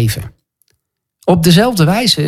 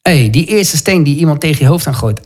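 normal talking voice

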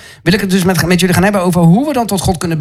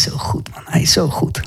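unvoiced, hushed whisper of praise; 7 dB quieter than usual. Real, not acted. Preacher lost in worship, whispering his praise. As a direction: breathe it out in awe.

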